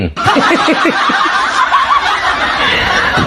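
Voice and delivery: breathy high scoffing laugh; long high laugh. Real, not acted. Host laughing at JK's claim, incredulous.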